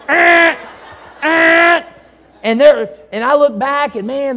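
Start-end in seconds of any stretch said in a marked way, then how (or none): 0.1-1.8 loud, high mock car honks; honking horn imitated with the voice. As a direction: blast it like an angry horn.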